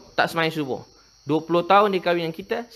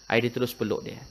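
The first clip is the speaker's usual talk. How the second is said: normal talking voice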